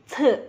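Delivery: "C" as ts with a puff, tongue behind teeth; aspirated dental C. Shown as a demonstration. Teacher showing C with air, aspirated. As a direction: spit air lightly through "C". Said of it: "itu keluar angin".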